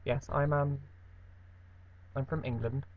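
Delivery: usual speaking voice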